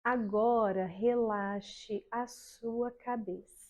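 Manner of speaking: slow, soft, soothing relaxation voice; guided meditation. Shown as a demonstration. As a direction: lull the class into calm, very slowly. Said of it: "E aí você vai começar assim, ó".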